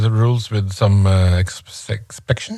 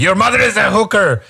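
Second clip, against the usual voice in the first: mock insult; 9 semitones higher, faster than usual. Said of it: "if you say that to a Pakistani guy".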